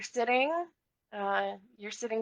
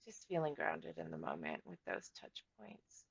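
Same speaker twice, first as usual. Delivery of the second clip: calm meditation guide with long pauses; unhurried body-scan narration; guided meditation; 4 semitones lower than usual. Acted. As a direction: ease listeners into their bodies, leaving space between phrases.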